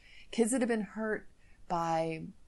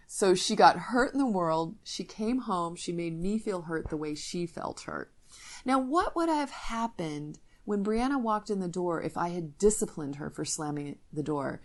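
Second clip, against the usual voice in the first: normal talking voice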